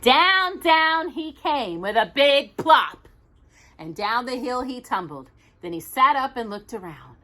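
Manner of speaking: ordinary delivery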